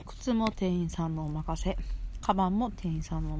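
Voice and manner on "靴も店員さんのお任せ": normal talking voice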